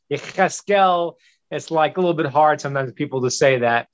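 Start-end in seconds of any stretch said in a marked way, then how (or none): 0.1-1.1 Yecheskel said slowly with a throaty Hebrew ch, yi-CHES-kel; Hebrew pronunciation. Shown as a demonstration. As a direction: Yecheskel slow, with the guttural ch from the back of the throat. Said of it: "I got a lot of ch sounds".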